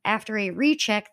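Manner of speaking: normal talking voice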